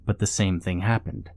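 usual speaking voice

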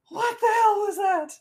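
very high, startled voice; startled stranger. Acted. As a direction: yelp it in confusion. GM voices shark-man again.

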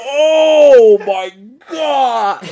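loud high wail breaking into a laugh; pained cry. Real, not acted. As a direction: cry out from the sting, half laughing. Reacting to burning nose.

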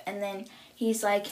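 normal talking voice

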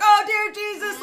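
high, loud mock-panicked cry; mock fright. Host acting out her scare at the show, mock panic. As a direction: exclaim in hammed-up alarm. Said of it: "And I'm like".

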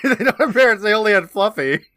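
loud, high, through laughter; amused outburst. Real, not acted. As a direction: burst out incredulously, nearly cracking up. Laughing riff on the absurd plot.

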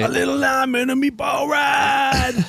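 high, slow goofy shout. Pretend. Silly shouted line.

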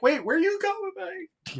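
put-on high, far-off girlish call; girlfriend voice. Girlfriend calling after fleeing Tommy, high girlish voice.

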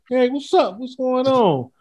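high cheerful mock hello; mock friendly greeting. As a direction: greet the kids brightly, high.

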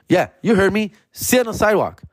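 high, smug command; hammed-up smug cop; mock cop insisting. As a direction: rub it in like a cocky cop.

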